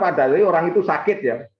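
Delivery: normal talking voice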